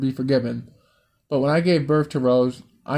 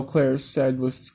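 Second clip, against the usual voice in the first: plain reading voice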